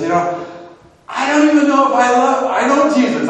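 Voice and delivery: high, loud, strained confession; anxious outburst. Retold or imitated. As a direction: blurt it out in distress. Man blurting out his doubt.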